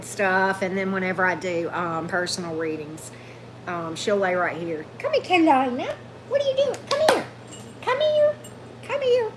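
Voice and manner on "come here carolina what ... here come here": high, coaxing pet voice to the dog; sing-song sweet talk to lure a shy dog out; calling the dog. Real, not acted.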